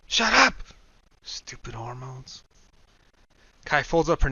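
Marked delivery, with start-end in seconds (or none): 0.1-0.5 much higher, louder self-rebuke; shouting at himself. Acted. Brian yelling at himself inside his head, sudden loud. 1.2-2.4 slow, much softer whisper; whispered grumble. Acted. Brian cursing his own crush, slow whisper.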